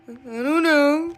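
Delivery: high, loud dopey mock cluelessness; all stupid. Leon asked what happens now, looking all stupid. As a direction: play dumb, hammed-up and clueless. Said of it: "looking at her all stupid like".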